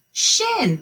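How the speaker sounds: "Shin" high and quick; fast excited reveal. Shown as a demonstration. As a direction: pop out "Shin" as the answer.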